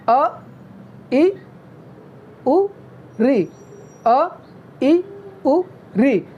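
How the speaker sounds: अ, इ, उ, रि each clipped very short; quick short vowel sounds; short vowel demo. Shown as a demonstration. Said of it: "जैसे अ". (ह्रस्व स्वर, बहुत कम समय में)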